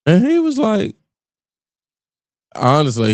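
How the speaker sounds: normal talking voice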